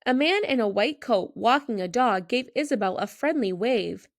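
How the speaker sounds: ordinary delivery, nothing marked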